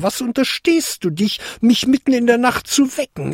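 put-on high, imperious old-lady voice; indignant fairy godmother. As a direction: scold the intruder, pitch rising as you go.

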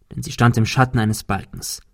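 plain reading voice

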